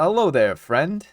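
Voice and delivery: a slightly put-on hello to a stranger; friendly greeting in character. Nacho popping up at the grate, mildly hammed-up greeting. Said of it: "he would say".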